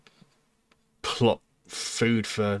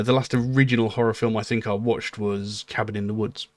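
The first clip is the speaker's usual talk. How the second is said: ordinary delivery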